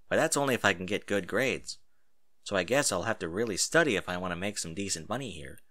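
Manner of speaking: usual speaking voice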